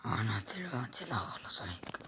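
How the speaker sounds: low whispered beast threat; monster voice. Acted. Monster vowing to finish off the boy, low whisper. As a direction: hiss the threat like a creature.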